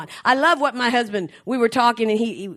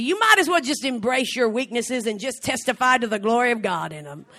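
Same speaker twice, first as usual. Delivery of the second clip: ordinary talk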